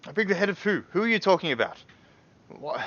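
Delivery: genuinely puzzled pushback; confused protest. Pretend. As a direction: player baffled by the accusation. Player confused reply.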